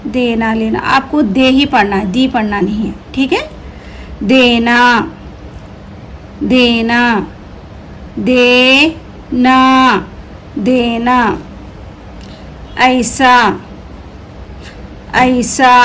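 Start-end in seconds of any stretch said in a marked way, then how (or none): 4.2-15.7 very slow, syllable by syllable; slow drawn-out reading. Shown as a demonstration. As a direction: stretch देना out slowly for learners. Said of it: "teacher stretches the word for pupils".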